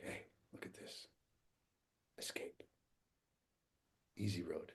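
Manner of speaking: very soft, barely voiced enticement; imitated whisper. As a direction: whisper it like a tempting voice. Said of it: "'cause it's whispering".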